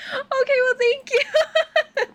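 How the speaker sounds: very high, sweet thank-you with a laugh; high, giggly mock politeness; retold sweet reply. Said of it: "he was really sweet".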